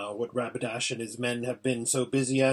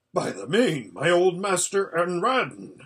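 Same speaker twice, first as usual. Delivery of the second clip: startled exclamation; 5 semitones higher, 5 dB louder than usual. A narrator's reading. Horse hears of his old master, startled exclamation. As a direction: voice lifts higher and louder in recognition.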